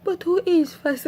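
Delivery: high, meek little question; imitated humbled, small high voice; humble. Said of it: "he was all humble".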